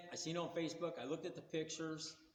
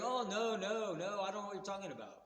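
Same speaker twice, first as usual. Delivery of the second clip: higher-pitched mock protest; quick-to-deny retold voice, pitched up; defensive denial; 5 semitones higher than usual. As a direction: imitate a caught seller denying it all. Acted denial by caught seller.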